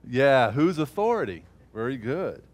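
ordinary talk, nothing marked

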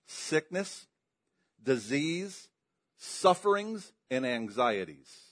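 ordinary talk

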